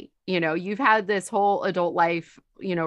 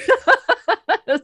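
loud, high burst of laughter; big laugh; 9 dB louder than usual. Real, not acted. Ending the water story, loud high laughing. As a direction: crack up at your own story.

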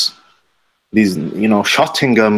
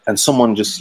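usual speaking voice

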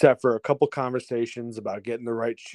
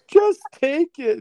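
loud, high, slow wail; hammed-up tearful insisting, stretched out; mock tearful; 6 dB louder, slower than usual. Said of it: "And I'm crying".

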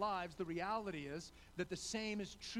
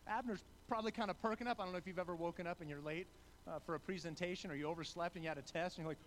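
ordinary talk, nothing marked

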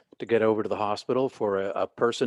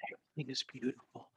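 whispered repeat; 3 semitones higher, 13 dB quieter, faster than usual. Retold or imitated. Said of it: "I'm whispering in his ear".

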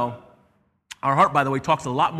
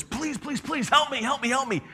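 much higher pleading servant, begging; desperate pleading; 10 semitones higher than usual. Acted. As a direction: beg your lord for mercy, voice up high. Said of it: "He's stressed out".

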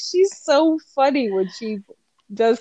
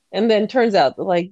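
ordinary talk, nothing marked

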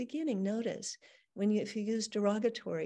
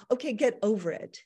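louder, curt dismissal; impatient brush-off; 8 dB louder than usual. Retold or imitated. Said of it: "you wouldn't say".

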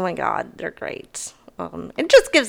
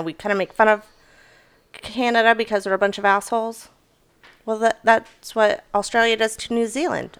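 normal talking voice

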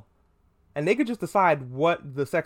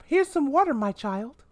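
exaggerated mom voice; 10 semitones higher than usual. Mom insisting her son take water, pitched way up. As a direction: push the offer way up into mock sweetness.

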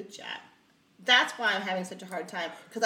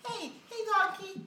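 Mike Tyson; 10 semitones higher, 5 dB quieter than usual. Retold or imitated. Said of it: "I could see Mike Tyson do it".

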